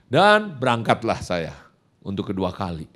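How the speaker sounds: ordinary talk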